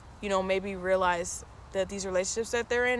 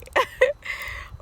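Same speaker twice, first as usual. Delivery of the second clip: high squealing laugh. Real, not acted. Laughs at mom's quote.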